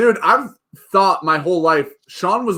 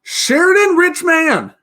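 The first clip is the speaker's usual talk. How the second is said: announcing name; 11 semitones higher, 5 dB louder, slower than usual. Real, not acted. Reading super chat name.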